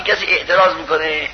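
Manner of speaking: usual speaking voice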